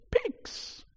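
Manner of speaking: high, strained, airy squeal; hushed, high mock shout; mock demon shout. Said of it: "the demons shouted".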